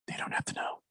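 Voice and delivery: secret whispered aside. Real, not acted. Cohost joking that listeners needn't know, in a whisper. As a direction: lean in and whisper it.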